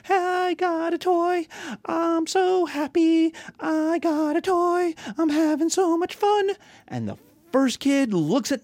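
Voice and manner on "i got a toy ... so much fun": put-on high, slow singsong child voice; gloating little kid. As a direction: taunt like a small kid showing off a toy. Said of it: "their sister going".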